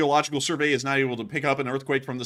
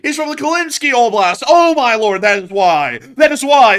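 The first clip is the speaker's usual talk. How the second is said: excited; 8 semitones higher, 12 dB louder, slower than usual. Real, not acted. Loud amused realization.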